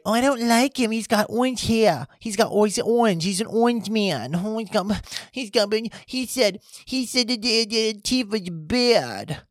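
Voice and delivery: high, babyish mocking whine; put-on crybaby voice; whiny baby. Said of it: "You sounded like a goddamn baby".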